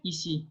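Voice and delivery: "ici" as ee-see, with a clean "s"; French pronunciation model. Shown as a demonstration. Teacher asking for a repeat, "ici" with an "s" sound. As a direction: model "ici" without any "sh". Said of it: "try to pronounce again".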